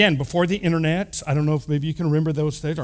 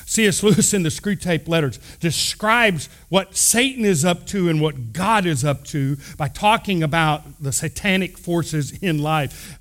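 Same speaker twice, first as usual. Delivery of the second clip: ordinary talk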